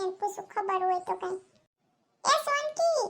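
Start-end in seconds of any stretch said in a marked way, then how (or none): 2.2-3.0 put-on high raised call to a girl passing by. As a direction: shout her name to stop her. Calling out to Sonki. (ઊંચા સાદે બૂમ પાડવી)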